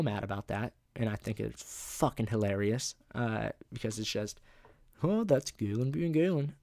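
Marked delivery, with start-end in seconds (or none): 5.0-6.5 higher, lightly put-on shrugging staffer; a hint of a goofy adult voice; amused dismissal. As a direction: toss it off like an indulgent grown-up. Staff excusing his antics.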